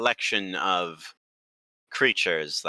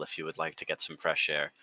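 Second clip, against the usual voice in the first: normal talking voice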